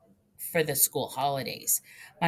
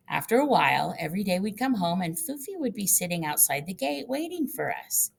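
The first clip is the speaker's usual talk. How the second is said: ordinary delivery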